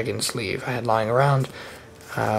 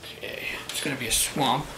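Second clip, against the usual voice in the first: whispering; 10 dB quieter than usual. Real, not acted. Quietly signing a land.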